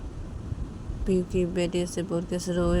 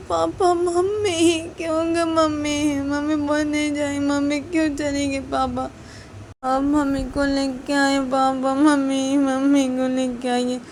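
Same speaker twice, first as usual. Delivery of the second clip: put-on high, small child's voice, begging and tearful; little girl pleading; 8 semitones higher, 6 dB louder, slower than usual. Little daughter to her father, begging tearfully for mummy.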